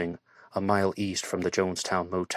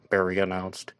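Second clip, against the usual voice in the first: plain reading voice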